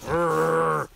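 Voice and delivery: drawn-out throaty "urgh"; strained grunt. Real, not acted. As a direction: grunt while wrestling an excited dog. Struggling with the excited dog.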